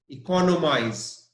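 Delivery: economize with co clearly stressed. Shown as a demonstration. Teacher repeats the stressed form for students. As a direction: lean on the co of economize. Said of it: "the stress is on C O".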